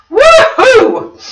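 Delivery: very high, loud woohoo; real excited whoop; cheer. Real, not acted. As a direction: whoop it out like a party. Greeting viewers, cheer.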